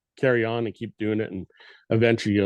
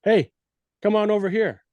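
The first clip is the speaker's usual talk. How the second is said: pitched up high and pushy; aggressive call; 10 semitones higher, 3 dB louder than usual. Shown as a demonstration. Elk hunter demonstrating an aggressive call to a listener, pitched up and pushy. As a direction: demand they come over, not a casual invite. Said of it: "one's a little more aggressive".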